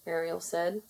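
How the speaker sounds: ordinary delivery, nothing marked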